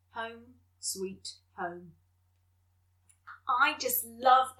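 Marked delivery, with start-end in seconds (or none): 0.1-1.9 tender. Acted. Story's tender ending.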